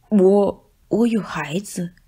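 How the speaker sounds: soft, half-whispered shy reply; quiet answer. Acted. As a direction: answer softly, a bit ashamed. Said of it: "大肥猫小声地回答道". (大肥猫小声地回答)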